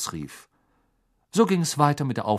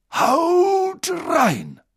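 high, loud cheerful command; booming call; 6 dB louder than usual. A narrator's reading. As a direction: call the feast open to the whole hall. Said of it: "seine Stimme hallte von den Wänden".

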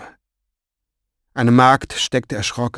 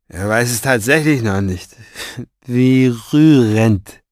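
mocking; 5 dB louder than usual. Villain mocks captive's ignorance.

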